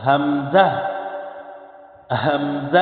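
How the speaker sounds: হামজা with a sharp catch on the start; hamza with a push. Shown as a demonstration. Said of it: "ধাক্কা দিয়ে পড়তে হবে". (ধাক্কা দিয়ে হামজা পড়া)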